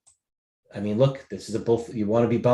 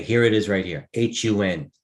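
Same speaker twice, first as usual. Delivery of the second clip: usual speaking voice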